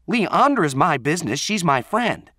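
high, loud, insistent protest; heated argument. Acted. Acted quarrel between Renee and Allie.

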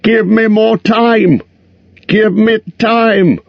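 high, slow pleading cry; frantic plea, drawn out; desperate wish to shout. A narrator's reading. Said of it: "He wanted to shout out".

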